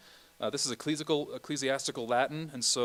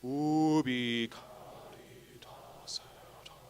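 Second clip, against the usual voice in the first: whispered Latin; much slower than usual. Shown as a demonstration. Director whispers along with the choir's repeat. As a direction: whisper ubi caritas et amor slowly.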